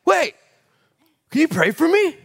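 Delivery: high, loud call to wait; chasing a man down, calling out; pleading call. Retold or imitated. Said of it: "and I was like".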